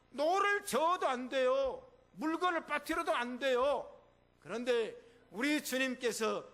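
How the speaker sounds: high, loud sermon delivery; fervent preaching. Acted. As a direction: pastor voicing Jesus to the sea, preaching with force. Preaching at full force.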